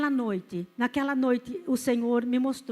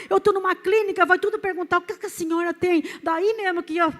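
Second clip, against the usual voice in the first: raised, urgent pulpit voice; impassioned church testimony, pitched up and pressing; fervent testimony preaching; 7 semitones higher, 8 dB louder than usual. Real, not acted. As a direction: testify with full force, driving each phrase home. Testimony preached at full force.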